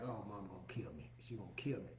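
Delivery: low, breathless whispered panic; scared kid whispering to himself that mama will kill him; panicked whisper. Retold or imitated. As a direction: whisper it like a kid who knows he's in trouble. Retold panic after hitting brother.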